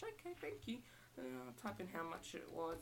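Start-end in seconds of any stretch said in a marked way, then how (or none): 0.0-0.8 high, soft thank-you at the till; cashier voice. Shown as a demonstration. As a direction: thank the customer sweetly. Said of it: "go through the routine again".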